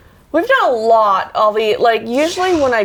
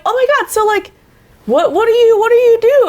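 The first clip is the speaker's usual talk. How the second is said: high, louder eager question; excited gushing; 11 semitones higher, 4 dB louder than usual. Retold or imitated. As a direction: gush with excitement over celebrities. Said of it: "they're like".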